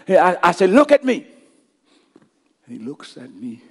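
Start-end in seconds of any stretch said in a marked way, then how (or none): 0.7-1.3 put-on high, raised-voice plea to a child; a father calling his son's eyes to him; urgent command. Father calling his panicking son.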